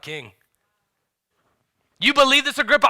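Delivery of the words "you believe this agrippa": loud, high, pressing challenge; raised-voice confrontation; bold challenge. Acted. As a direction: call him out boldly. Acts Paul calling out the king.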